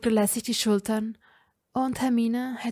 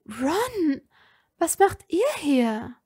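higher, puzzled question to a friend; surprised; 7 semitones higher, slower than usual. A narrator's reading. Hermine surprised to find Ron at the door. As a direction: ask him, caught off guard.

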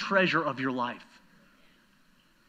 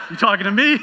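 tough-guy movie quote; 6 semitones higher, 12 dB louder than usual. Retold or imitated. Quoting famous movie line.

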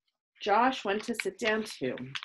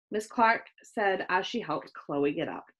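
ordinary delivery

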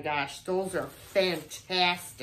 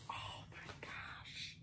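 hushed awed whisper; 23 dB quieter than usual. Real, not acted. Vlogger unwrapping vintage decorations, awed whisper. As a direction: breathe it in wonder. Marvelling at cards.

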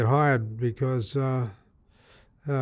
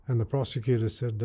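normal talking voice

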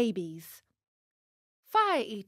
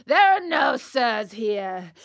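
loud ogre's dismissal; booming, stern puppet master; gruff shouted rebuff; 8 dB louder than usual. Acted. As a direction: shout the beggar down. Fire Eater shouts down the plea.

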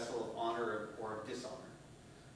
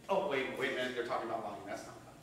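ordinary talk

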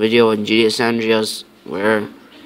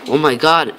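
ordinary delivery

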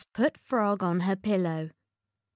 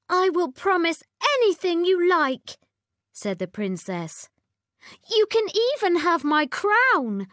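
high, loud eager princess; pleading spoiled princess; cartoon princess; 9 semitones higher, 4 dB louder than usual. A narrator's reading.

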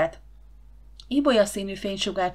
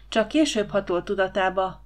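ordinary delivery, nothing marked

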